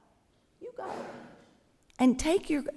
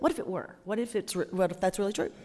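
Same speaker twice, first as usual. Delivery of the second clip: ordinary delivery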